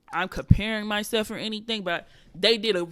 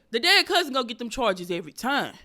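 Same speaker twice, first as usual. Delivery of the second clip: louder, forceful repeat of a joke line; emphatic punchline; 3 semitones higher, 7 dB louder than usual. Acted. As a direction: punch it out loud and insistent.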